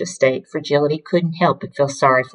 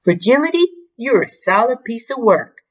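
higher, raised-voice call; 6 semitones higher, 4 dB louder than usual. A narrator's reading. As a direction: call back to her while leaving. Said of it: "Rudy called".

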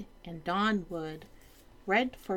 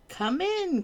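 high cheerful king call; jolly king; 11 semitones higher than usual. Acted. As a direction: sing out the invitation. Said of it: "cried the king".

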